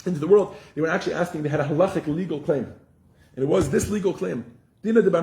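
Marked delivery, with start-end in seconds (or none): 0.0-0.6 hypothetical angels complaining. Retold or imitated. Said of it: "They weren't just saying".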